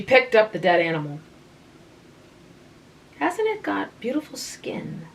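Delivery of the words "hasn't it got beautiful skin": soft, higher musing. A narrator's reading. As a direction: admire it softly. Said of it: "she mused".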